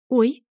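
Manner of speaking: startled high "Ой!"; squeak. Acted. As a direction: let out a little yelp of surprise. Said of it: "пискнула".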